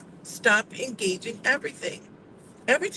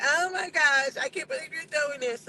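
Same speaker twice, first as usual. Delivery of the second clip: mock whining; 5 semitones higher than usual. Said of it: "start whining and crying and be like".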